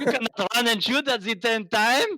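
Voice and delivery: high, mock French outrage; French accent. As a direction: do a hammy shocked Frenchman. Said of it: "the Europeans were like".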